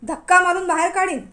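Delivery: escalating furious shout. Acted. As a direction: push the threat out even harder. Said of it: "त्याचा आवाज अजून चढला".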